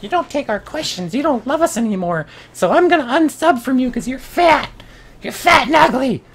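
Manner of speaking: high, whining mock fan; squeaky pouting viewer complaints; whiny commenter voice. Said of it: "you guys were like".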